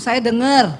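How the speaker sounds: ordinary talk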